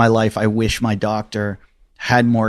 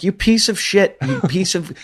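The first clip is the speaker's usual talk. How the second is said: high, teasing jab at a friend; mock insult; 8 semitones higher than usual. Ribbing a kidney doctor friend about McDonald's, high mock insult. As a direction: rib him like a buddy, pitched up.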